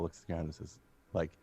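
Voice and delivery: ordinary delivery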